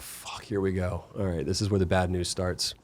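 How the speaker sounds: quiet resigned dread, half to himself; dreading under breath. Retold or imitated. Bracing for bad news from doctor.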